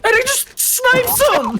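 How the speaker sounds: soaring high excited yell; excited shout. Real, not acted. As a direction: explode with hype at the play. Clutch snipe, caster erupts.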